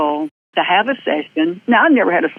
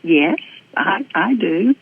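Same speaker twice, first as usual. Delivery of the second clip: reluctant answer; 4 semitones higher, much slower than usual. Retold or imitated. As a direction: give it grudgingly, hold back. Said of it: "It's like pulling teeth".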